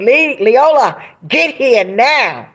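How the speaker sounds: Whitney's urgent summons. Retold or imitated. Imitating a friend summoning her by phone, urgent. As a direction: stretch each word into an insistent order.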